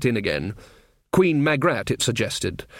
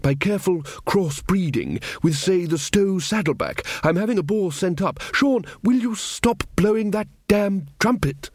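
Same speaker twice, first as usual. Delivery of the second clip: Verence's voice; 7 semitones higher than usual. Acted. King Verence scolding the trumpeter, higher, fussy. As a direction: play the earnest little king going on about pigs.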